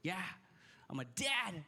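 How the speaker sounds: ordinary delivery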